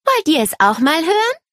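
put-on high child's voice, hopeful question; eager little girl. A narrator's reading. Nele inviting the boys to listen, eager. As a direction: pitch it up high and offer eagerly.

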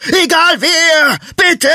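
very high, loud desperate plea; panicked. A narrator's reading. Butcher pleading with passers-by for rescue, panicked high shout. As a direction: shriek for help in panic. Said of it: "seine Stimme wurde panisch".

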